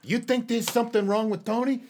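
a hint of a Jersey wiseguy; Paulie Walnuts impression. A wiseguy sharing worries about the boss, lightly voiced Jersey mobster. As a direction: murmur it like a worried mob crony. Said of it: "Paulie Walnut".

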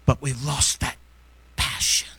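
low, breaking into whisper on passion; hushed plea. Real, not acted. Preacher begging God to keep his passion, hushed prayer. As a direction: pray it low, with long heavy pauses.

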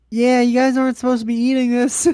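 high, loud put-on voice; voice of nature. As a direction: call it down as mock God. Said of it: "this is God's way of saying".

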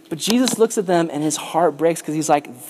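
ordinary talk, nothing marked

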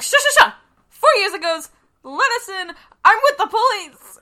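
high, loud bossy shushing; loud hushing. Acted. [name] claiming to be police to the homeowner, loud and bossy. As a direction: boss him aside like a cop.

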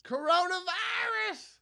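high, drawn-out shouted Coronavirus; [name] impression. Host mocking the viral clip to his co-host, high slow holler.

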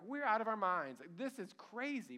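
high, loud, half-laughing exclamation; running women marvelling at their own madness; giddy disbelief. Acted. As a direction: blurt it out, giddy and amazed. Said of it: "probably even laughing".

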